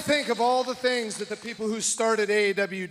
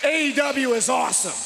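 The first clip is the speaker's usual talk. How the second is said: shouting to crowd; 6 semitones higher than usual. Real, not acted. Wrestling promo to live crowd.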